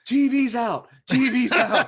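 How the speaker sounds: high, loud alarm call, imitated; calling from downstairs. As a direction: holler the bad news up from downstairs. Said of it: "all I hear is the line downstairs go".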